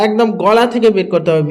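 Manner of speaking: ordinary talk, nothing marked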